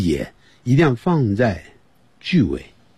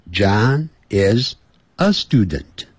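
slow, careful John is a student; slow model sentence; much slower than usual. Shown as a demonstration. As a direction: say each word slowly, stress the a. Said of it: "不要说John is student". (慢慢地示范)

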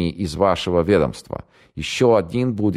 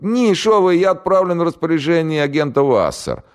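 put-on much higher, louder panicky young man; scared radio operator; 14 semitones higher, 4 dB louder than usual. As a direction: blurt it out in terror. Голос радиста. (испуганный пленный радист, высоко и громко)

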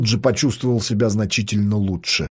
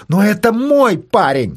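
high, slow, shouted protest; shouting; 9 semitones higher, 4 dB louder than usual. Acted. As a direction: yell it with all her voice. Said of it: "кричала Катя во весь голос".

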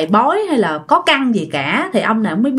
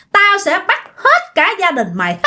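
high threat through laughter; laughing ghost threat; 9 semitones higher than usual. Acted. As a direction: threaten the whole family, laughing. (vừa cười vừa đe dọa, giọng vong cao)

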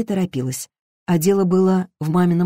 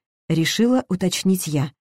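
usual speaking voice

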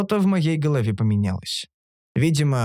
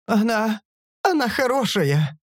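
higher-pitched, halting tearful plea; tearful mumbling; 8 semitones higher than usual. A narrator's reading. Lawyer mourning the dead girl, tearful. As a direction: plead for her through tears. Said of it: "забормотал юрист сквозь слезы".

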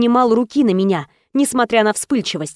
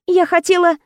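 heroine pleading, higher; 8 semitones higher than usual. Acted.

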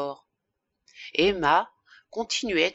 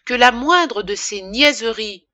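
raised, louder exclamation; indignant cry; 3 semitones higher, 7 dB louder than usual. A narrator's reading. Woman seizing her lover's cufflinks as proof, crying out indignantly. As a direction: cry it out in outrage. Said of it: "s'écria-t-elle".

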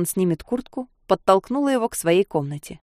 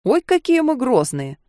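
good-natured laugh; 7 semitones higher than usual. Acted. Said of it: "Беззлобно засмеялся он".

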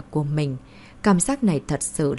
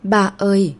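louder call to father, a touch choked; 5 dB louder than usual. A narrator's reading. Daughter finding her father outside surgery, choked-up call. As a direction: call out to Dad, mildly choked with feeling. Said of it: "Thẩm Mã Lan nghẹn ngào gọi".

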